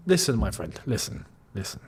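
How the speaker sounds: low, hushed, half-whispered appeal; hushed plea. Pretend. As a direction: lean in and whisper to him. Confiding to player Nuno Mendes.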